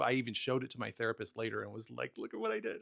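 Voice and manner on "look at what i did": proud showing off. Retold or imitated. Showing the therapist his text.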